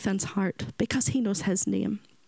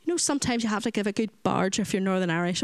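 usual speaking voice

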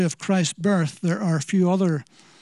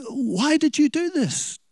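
mild scolding; 7 semitones higher, slower than usual. Retold or imitated. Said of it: "she scolded him".

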